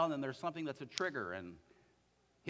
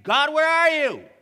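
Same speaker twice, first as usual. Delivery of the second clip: shouted high and loud at God, desperate; anguished angry cry; 12 semitones higher, 19 dB louder than usual. Acted. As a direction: cry out to God in anger and grief. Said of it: "He's angry as well as sad".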